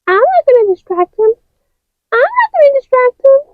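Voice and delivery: high, fast refusal; high quick toy voice; high character voice. Acted. Other figures refuse.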